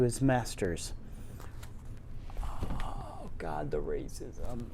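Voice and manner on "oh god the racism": muttered aside. Real, not acted. Muttered aside about the book.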